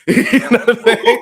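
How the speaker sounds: loud, high laughing question. Real, not acted. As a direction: laugh it out, slow. Laughing after mock boast.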